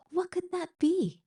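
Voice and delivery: lifted high in wonder; storyteller's delighted question; curious wonder. A narrator's reading. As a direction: ask it wide-eyed, high up. George curious about the splash.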